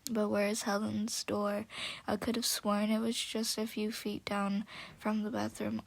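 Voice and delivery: ordinary delivery, nothing marked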